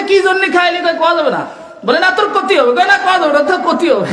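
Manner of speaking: high-pitched, rapid back-and-forth; insistent retold argument. (চড়া গলায় পীড়াপীড়ির অভিনয়)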